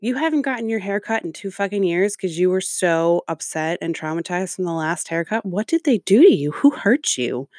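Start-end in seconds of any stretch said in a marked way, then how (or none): 5.4-7.5 mock pity. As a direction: ask it with fake concern. Mocking sympathy to reviewer.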